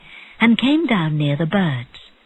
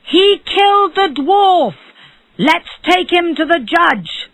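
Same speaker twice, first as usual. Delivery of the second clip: crowd shout; 11 semitones higher, 4 dB louder than usual. A narrator's reading. Townspeople accuse the rich man from their windows, shouting. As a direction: shout it out for all to hear.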